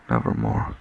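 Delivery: low ominous bird voice; raven's answer. A narrator's reading. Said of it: "Quoth the raven".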